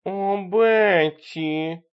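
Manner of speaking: very slow, high, drawn-out call; eerie singsong summons; voice from below. A narrator's reading. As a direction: call up the stairs, stretching each vowel. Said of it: "послышался голос снизу".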